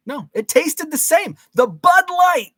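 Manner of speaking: very high, drawn-out exclaiming; exaggerated high-pitched protest; emphatic insistence. Real, not acted. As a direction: squeal it slowly, hammering the point home. Emphatic mock insistence on beer taste.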